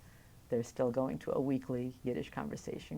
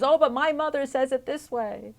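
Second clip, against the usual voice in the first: put-on high, louder child's voice; imitating a child; 10 semitones higher, 8 dB louder than usual. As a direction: pipe up like a kid correcting the teacher. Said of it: "and then telling their teachers".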